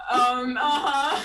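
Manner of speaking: high and loud, breaking up laughing; can't stop laughing, near tears; laughing hard. Real, not acted.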